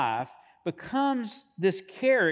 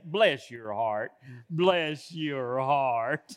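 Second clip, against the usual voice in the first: slow, drawn-out Southern accent on bless your heart; 4 semitones lower, much slower than usual. Shown as a demonstration. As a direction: lay on a thick Southern accent, slowly. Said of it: "the best Southern accent and, and dialect that you can muster up".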